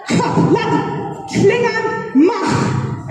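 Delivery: Ka, la, klingan, mach said syllable by syllable, clear and careful; Klingon pronunciation. Shown as a demonstration. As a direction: teach the Klingon line slowly. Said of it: "it sounds like this".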